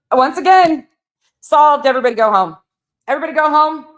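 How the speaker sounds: loud, high victory call; mock triumphant shout. Mocking the sleuth by declaring the case solved. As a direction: announce it like the case is closed.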